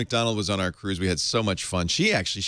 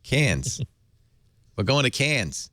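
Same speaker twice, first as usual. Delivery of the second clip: lightly put-on Australian "Cairns"; Australian accent; 4 semitones higher, slower than usual. Shown as a demonstration. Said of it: "Australian accent".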